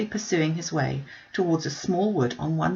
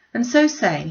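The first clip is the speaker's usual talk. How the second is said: ordinary delivery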